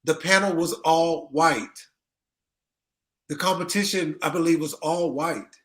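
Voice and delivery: ordinary delivery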